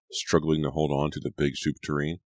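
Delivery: ordinary delivery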